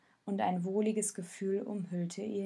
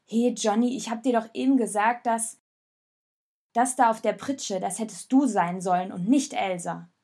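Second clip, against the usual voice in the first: loud, cutting back at him; defensive interruption; 6 dB louder than usual. Acted. Raoul interrupts Jonas, loud and defensive. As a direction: snap back to stop the blame. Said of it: "hob abwehrend die Hände und unterbrach ihn".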